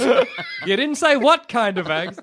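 slow, high, laughing protest; mock indignant complaint. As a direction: play a put-out customer, half laughing. Acted cafe customer's complaint.

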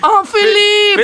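overjoyed. Acted. King overjoyed at reunion.

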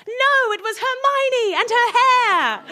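high, slow, drawn-out proclamation; mock-dramatic reveal. A narrator's reading. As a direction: announce it as a grand shocking reveal.

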